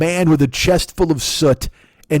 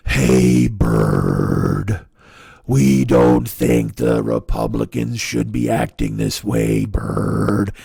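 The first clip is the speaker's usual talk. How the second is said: low, slow, plodding Snuffleupagus drone; slow deliberate Schumer; 8 semitones lower, much slower than usual. Retold or imitated. Mocking Chuck Schumer to Democrats in a low slow drone. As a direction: drag each word out like a sleepy giant puppet. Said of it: "Just these deliberate ways of speaking".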